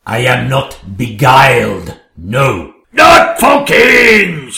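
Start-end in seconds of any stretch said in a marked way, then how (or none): 2.9-4.6 loud, slow, high shout. Acted. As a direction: bellow it out in refusal. King's outburst of refusal.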